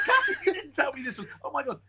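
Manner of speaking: high, fast, laughing through the words; giggling in shocked disbelief. Retold or imitated. Telling Catherine off in laughing astonishment. Learning who the husband is.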